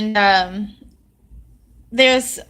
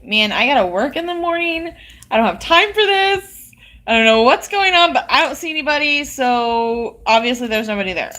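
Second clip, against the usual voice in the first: imitating a fed-up husband, pitched up; annoyed husband; 3 semitones higher than usual. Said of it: "he's getting annoyed".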